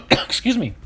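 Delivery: coughing after inhaling a bug; just swallowed a bug. Real, not acted. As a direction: cough through the words, throat irritated. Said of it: "I think I inhaled a moth".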